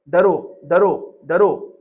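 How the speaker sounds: loud, hammered repeated command; fear commanded. Retold or imitated. Preacher mimics a fear-preaching faith, loud commands. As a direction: bark the word like a threat.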